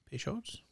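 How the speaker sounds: Peychaud's whispered as an unsure guess; unsure pronunciation. Real, not acted. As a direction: whisper "Peychaud's" quietly with a questioning rise. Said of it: "is it Peychaud's?".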